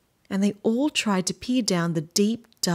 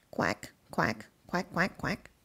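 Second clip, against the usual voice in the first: mother duck quacking; 8 dB quieter than usual. Acted. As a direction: quack quietly, dying off at the end. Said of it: "Alexander's mother quacked and quacked".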